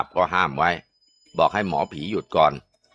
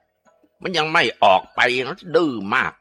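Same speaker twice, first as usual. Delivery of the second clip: angry shaman; 7 semitones higher, slower than usual. Acted. Shaman scolding stubborn spirit.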